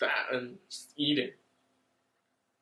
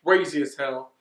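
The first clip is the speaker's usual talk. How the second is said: louder, worked-up what the fuck building to a yell; real hyped-up outburst; hyped disbelief; 2 semitones higher, 12 dB louder than usual. Real, not acted. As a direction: get carried away by the twist. Excited recap of twist.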